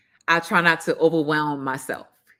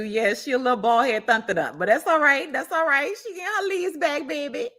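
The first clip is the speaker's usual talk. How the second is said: high, sing-song sass; answering a chat comment with sass; sassy reassurance; 8 semitones higher than usual. Real, not acted. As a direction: reassure playfully with attitude. Sassy reply to commenter about plant.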